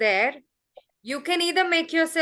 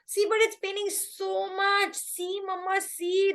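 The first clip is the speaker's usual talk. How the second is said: put-on high pleading child voice, much higher; whiny child; 8 semitones higher than usual. Little boy whining to his mama to prove it hurts, high-pitched. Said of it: "then he has to".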